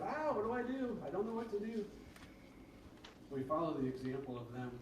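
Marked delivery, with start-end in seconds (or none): 0.0-1.8 high-pitched mock panic; playful cry of helpless panic; panic mode. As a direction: act out a flustered panic, voice jumping up. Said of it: "Get in the panic mode".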